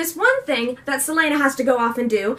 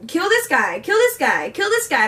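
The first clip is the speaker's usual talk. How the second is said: higher, commanding tyrant king; bossy king voice; 5 semitones higher than usual. Retold or imitated. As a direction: bark orders like a bored tyrant. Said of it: "He's just like".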